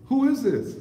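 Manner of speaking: sharp, loud and high demand. Real, not acted. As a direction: call out to the caller. Caller won't identify himself.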